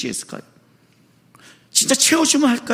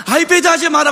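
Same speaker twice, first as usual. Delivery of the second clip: higher, louder mother's order; mother scolding; 6 semitones higher, 5 dB louder than usual. Retold or imitated. Mother scolding her child about the iPad, higher and louder. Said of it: "그 아이패드에".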